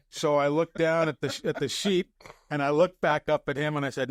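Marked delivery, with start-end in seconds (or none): none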